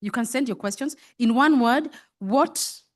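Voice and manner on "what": ordinary delivery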